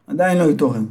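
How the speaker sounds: plain reading voice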